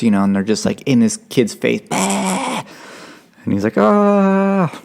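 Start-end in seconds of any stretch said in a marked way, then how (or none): 1.9-2.6 mock yell. Instructors screaming in kid's face. 3.8-4.7 high mock scream of panic; imitated frightened yell. Recruit screaming back.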